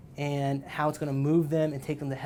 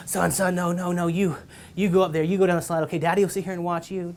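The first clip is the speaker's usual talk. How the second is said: put-on louder, higher, hurried brush-off to a toddler; flustered dad; 3 semitones higher, 6 dB louder than usual. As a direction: wave the kid off, out of breath. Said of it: "I'm like".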